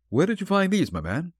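high-pitched, earnest opening; keen, sincere inquiry; earnest question. A narrator's reading. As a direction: ask earnestly, starting high and dropping away. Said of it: "asking earnestly".

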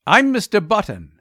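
barely manage to get it out; nervous father forcing the words out; strained, faltering. A narrator's reading. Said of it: "he managed to articulate".

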